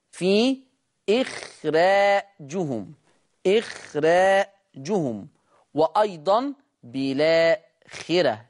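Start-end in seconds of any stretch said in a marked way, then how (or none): 1.1-5.3 إخراجهم very slow with a light, thin ر. Shown as a demonstration. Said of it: "قرأ ورش بترقيق الراء".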